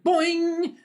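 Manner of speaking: high comic boing; springy boing noise. Acted. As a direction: pop it out like a spring snapping. Imitating corset bursting.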